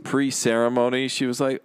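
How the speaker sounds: ordinary talk, nothing marked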